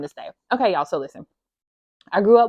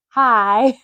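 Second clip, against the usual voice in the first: higher, louder, a touch of a silly put-on voice; put-on greeting voice; 5 semitones higher, 7 dB louder than usual. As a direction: chirp a hammed-up hi at the viewers. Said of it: "I don't know why I just started talking to y'all like that".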